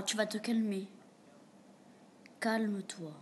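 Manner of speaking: ordinary talk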